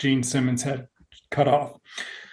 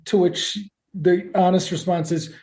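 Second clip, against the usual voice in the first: ordinary talk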